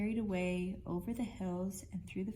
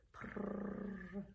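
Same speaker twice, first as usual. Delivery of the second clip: soft purring cat; 6 dB quieter than usual. Acted. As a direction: purr it like a content cat. Cat purring after meow.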